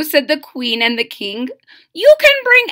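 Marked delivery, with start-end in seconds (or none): 1.9-2.7 high, loud, announcing; grand royal invitation. Acted. King and queen call out their invitation to the boy. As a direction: proclaim it like royalty.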